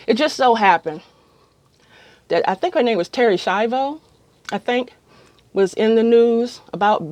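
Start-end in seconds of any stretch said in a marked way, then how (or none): none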